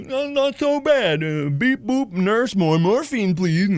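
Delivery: lightly dopey, slow drugged patient. Retold or imitated. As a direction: drift like you're high on morphine. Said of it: "that was me".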